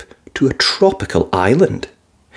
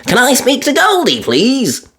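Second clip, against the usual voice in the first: put-on high little girl voice; Peppa voice; 12 semitones higher than usual. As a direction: ask sweetly like a small child. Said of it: "said Peppa".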